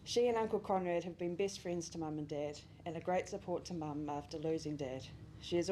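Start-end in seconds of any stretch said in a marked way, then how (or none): none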